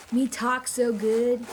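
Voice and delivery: loud, slow, dim-witted caveman talk; mock caveman grammar. Shown as a demonstration. Telling about a walk with the dog, hammed-up caveman voice. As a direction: boom it out slowly like a proud caveman. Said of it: "trying to use terrible grammar".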